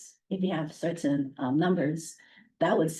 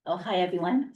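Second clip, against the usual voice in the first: ordinary talk, nothing marked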